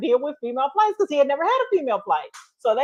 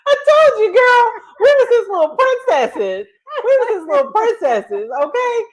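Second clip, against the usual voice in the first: high, loud, tickled squeal; laughing boast; 11 semitones higher, 5 dB louder than usual. Real, not acted. Trainee recalling being instructor's little princesses, laughing boast.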